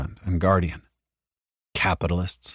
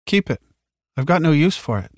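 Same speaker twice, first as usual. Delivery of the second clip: higher female voice; 7 semitones higher than usual. Acted. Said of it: "she said".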